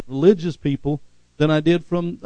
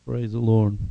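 ordinary delivery

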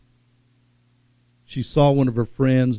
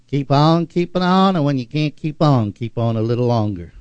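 put-on Georgia accent. Pastor quoting his first pastor, in a light Georgia accent. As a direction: slip into a Georgia accent, first line lifted. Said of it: "in that Georgia accent".

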